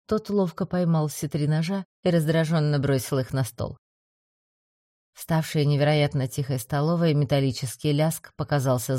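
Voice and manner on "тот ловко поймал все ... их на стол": usual speaking voice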